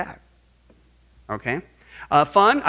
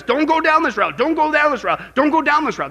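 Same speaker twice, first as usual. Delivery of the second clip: higher, louder repeated warning; urgent warning; 8 semitones higher, 7 dB louder than usual. Retold or imitated. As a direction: plead loudly, three times. Solomon warns the listeners.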